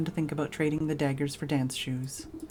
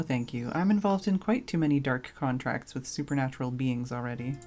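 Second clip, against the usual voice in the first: ordinary talk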